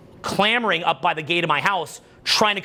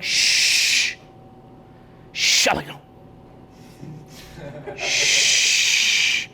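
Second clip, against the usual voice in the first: shushing; 2 semitones lower, much slower than usual. Retold or imitated.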